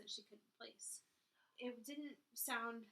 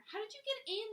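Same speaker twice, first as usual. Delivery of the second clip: demanding, much higher and louder; 12 semitones higher, 8 dB louder than usual. A narrator's reading. Elena demanding to know how the stranger got in. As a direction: demand an answer sharply. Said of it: "she demanded".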